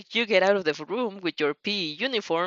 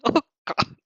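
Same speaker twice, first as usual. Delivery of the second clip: plain reading voice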